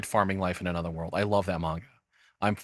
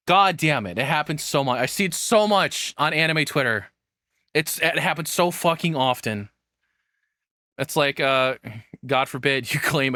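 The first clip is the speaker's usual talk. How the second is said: louder and higher, fed up; exasperated rant; 5 semitones higher, 3 dB louder than usual. Real, not acted. Streamer venting about anime Twitter, exasperated and louder. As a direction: let the frustration boil over.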